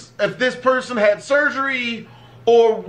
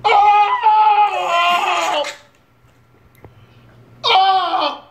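loud, high shocked yells; shocked yelling; 7 semitones higher, 6 dB louder, much slower than usual. Real, not acted. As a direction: cry out in disbelief.

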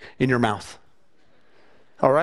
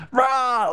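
short, high-pitched mock roar of frustration; frustrated roar; 7 semitones higher than usual. Preacher imitates Moses surrounded by complainers, mock frustrated roar. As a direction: imitate a man driven crazy, roaring briefly. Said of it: "he's just like".

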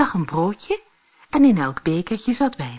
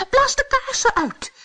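very high-pitched instruction; squeaky-high storybook character; little dwarf; 4 dB louder than usual. A narrator's reading. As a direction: pipe up as a tiny dwarf. Fifth dwarf gives instruction.

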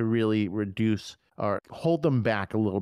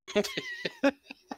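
high laugh; 4 dB quieter than usual. Real, not acted. Laughing at ghost scene recap.

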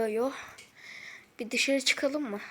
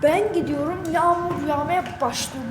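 loud, higher excited call; 4 semitones higher, 7 dB louder than usual. Real, not acted. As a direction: call out excitedly about the rain. Excited call as rain starts.

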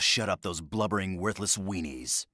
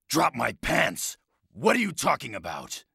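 outraged shout; 8 semitones higher, 3 dB louder than usual. Acted. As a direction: yell it in comic outrage.

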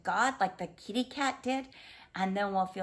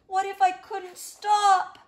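mock panic; 7 semitones higher, 6 dB louder than usual. A narrator's reading. Fear on the slide.

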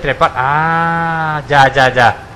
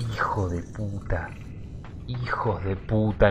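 cursing under breath; 7 semitones lower, 14 dB quieter, slower than usual. Real, not acted. Frustrated gamer curses in the dark.

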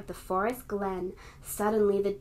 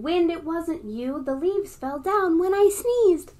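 a lightly voiced bear, higher and louder; child-story bear, slightly higher and louder; Bear's voice; 7 semitones higher, 6 dB louder than usual. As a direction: give Bear a hint of indignant pride. Bear answers the wind in story.

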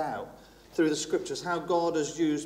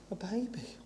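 whispered, much softer; hushed reveal; 3 semitones higher, 12 dB quieter than usual. Real, not acted. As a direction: breathe it like a secret. Revealing what God sent.

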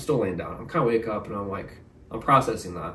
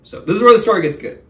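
louder and higher, selling the twist; hyped-up teaser; 9 semitones higher, 9 dB louder, faster than usual. Shown as a demonstration. To viewers, a hyped teaser before the twist. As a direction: announce the good part with a big push. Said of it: "hypes the story's turning point".

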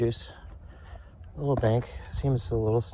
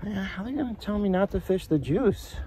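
high whiny complaint; 11 semitones higher than usual. Real, not acted. Complaining about no fishing.

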